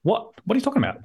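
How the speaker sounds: high, quick baffled retort; mock-bewildered, rapid high question; incredulous. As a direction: imitate someone taken aback, pitch leaping up. Imitates baffled trainee's reply.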